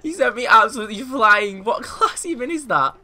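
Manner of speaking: loud, high, laughing shock; cracking up after being sent flying; laughing disbelief. Real, not acted.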